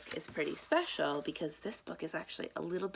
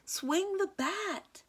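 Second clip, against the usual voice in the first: high, slow stretched yell; shouted order; 3 dB louder, much slower than usual. A narrator's reading. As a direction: urge him to swing. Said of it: "Coach Marie shouts".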